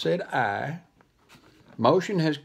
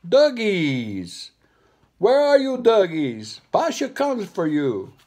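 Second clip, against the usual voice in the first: loud, high sing-song call from the monkey; monkey calling for the dogs, loud and high; 6 semitones higher, 6 dB louder, slower than usual. Acted. Monkey calling out to the dogs in a sing-song voice.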